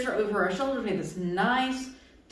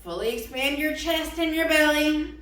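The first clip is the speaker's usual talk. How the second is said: ordinary talk, nothing marked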